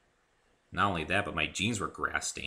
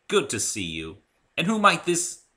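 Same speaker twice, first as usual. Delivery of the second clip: louder, rising, then cut off; refined Rarity; 9 semitones higher, 7 dB louder than usual. Acted. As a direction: welcome then break off mid-word.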